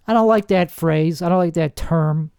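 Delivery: usual speaking voice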